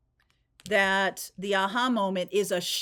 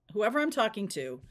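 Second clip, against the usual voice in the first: ordinary talk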